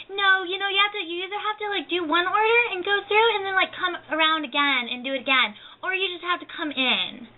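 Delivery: high, fast mock voice of a snippy cashier. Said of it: "She's like".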